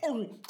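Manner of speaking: loud, high mock roar; hulking roar. Raging customer hulking out, hammed-up roar. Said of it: "He fucking hulked and was like".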